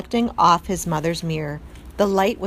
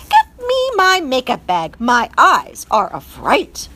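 loud, imperious French command; vain French mother; 5 semitones higher, 7 dB louder than usual. A narrator's reading. Mother mouse ordering her son to fetch makeup, imperious. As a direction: order it, louder and slow at the end.

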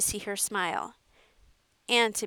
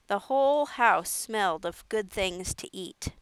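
ordinary delivery, nothing marked

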